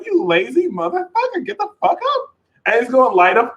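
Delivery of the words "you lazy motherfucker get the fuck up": high, pushy mock scolding; high-pitched teasing wake-up call; bossy Leo. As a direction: bark at a lazy partner to get out of bed. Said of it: "And the Leo is like".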